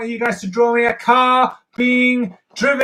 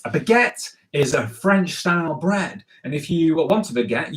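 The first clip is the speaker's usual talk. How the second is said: normal talking voice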